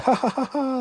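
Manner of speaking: very high hysterical cackle; hysterical laugh. Retold or imitated. The captive girl going crazy, very high hysterical cackle. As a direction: cackle like she's lost her mind.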